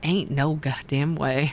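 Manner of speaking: slow, low Southern drawl; Southern accent. Pretend. Streamer joking about reading stories aloud, Southern accent. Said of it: "read the stories in the Southern accent".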